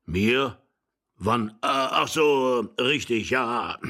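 drawn-out, doddering realization; old man remembering. Acted. Old husband finally catching on to his wife, doddering. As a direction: let it dawn slowly in an old voice.

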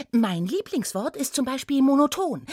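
lively high confession of a favourite; gushing gnome. Acted. Gnome to centaur, gushing about a favourite word. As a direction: introduce "monoton" brightly within the sentence.

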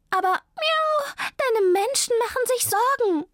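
very high, loud meowing plea; protesting cat. A narrator's reading. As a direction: wail the worry at high pitch. Zelda protests to runaway friend. (hoch und laut klagende Katze)

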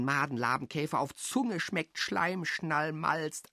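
normal talking voice

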